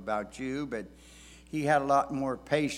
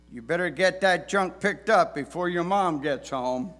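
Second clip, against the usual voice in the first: stern dad voice; 5 semitones higher, 6 dB louder than usual. Retold or imitated. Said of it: "words that many dads have said".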